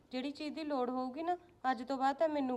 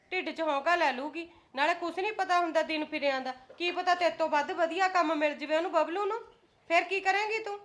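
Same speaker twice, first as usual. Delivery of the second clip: raised, scolding voice; 5 semitones higher, 6 dB louder than usual. Acted. Mother-in-law scolding the daughter-in-law, raised and angry. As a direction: tell her off, sharp and loud.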